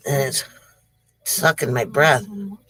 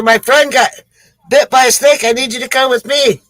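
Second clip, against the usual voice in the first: urgent plea; 7 semitones higher than usual. Acted. Joke character pleads with the doctor.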